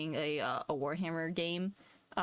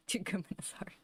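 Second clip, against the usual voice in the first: whispered echo; 4 dB quieter than usual. Acted.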